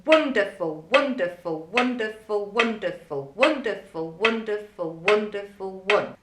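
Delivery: wonderful clipped hard, W landing on each click; strict militant chant to a metronome; militant counting. Shown as a demonstration. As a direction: count wonderful like a drill sergeant on the beat. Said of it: "listen to how militantly I say the word wonderful".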